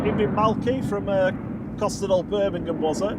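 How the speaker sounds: loud, hammed-up Brummie accent. Greeting Malky from Birmingham in a Brummie accent. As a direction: greet him in an over-the-top Birmingham accent. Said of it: "That's my best, uh, Brummie accent".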